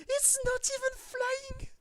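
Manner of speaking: a high, loud outraged squeal; shrieking disbelief. Real, not acted. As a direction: shriek at the screen. Shocked at the bug unit.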